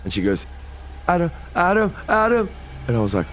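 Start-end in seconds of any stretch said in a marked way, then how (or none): 1.1-2.5 high scared calls, climbing higher on the last; imitated terror. Bandmate calls out from the back of the car, mock terrified. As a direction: mimic someone scared for their life calling out a name. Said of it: "she's genuinely scared for her life".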